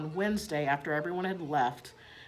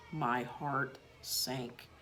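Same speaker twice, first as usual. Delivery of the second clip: lower, softer, very slow; heart sinking; 5 semitones lower, 8 dB quieter, much slower than usual. A narrator's reading. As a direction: let the disappointment drag the words down. Narrator disappointed.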